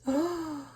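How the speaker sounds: sharp storybook gasp; sudden intake of breath; shocked gasp. Acted. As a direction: gasp in mock horror. Reacting to the book ending.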